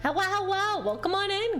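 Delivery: high excited welcome; sing-song hello to raiders; cute anime streamer. Real, not acted.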